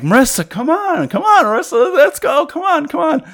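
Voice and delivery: high, strained pleading to hurry; acted insistent nagging; imitated urging. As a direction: coax her along urgently in a very high voice. Retells dragging her along, urging.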